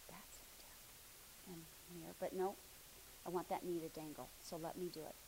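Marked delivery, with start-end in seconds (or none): 0.1-1.5 slow, soft, barely voiced; whispered praise. Real, not acted. Teacher quietly praising her student, slow whisper. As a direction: breathe the praise out slowly and softly.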